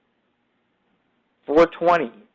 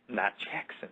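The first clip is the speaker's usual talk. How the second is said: breathy whisper, taking it back; whispered correction; 5 semitones lower, 14 dB quieter than usual. Real, not acted. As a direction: hush it like a secret slip. Backs off naming Jackson.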